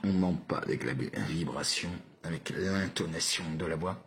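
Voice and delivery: low, soft and confiding; hushed low voice. Shown as a demonstration. Telling viewers about the voice's vibration, low and hushed.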